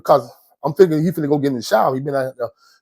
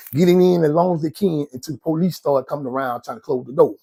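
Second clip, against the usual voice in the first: usual speaking voice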